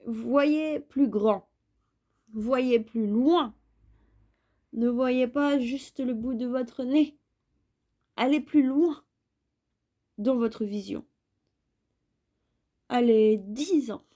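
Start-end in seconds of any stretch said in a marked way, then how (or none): none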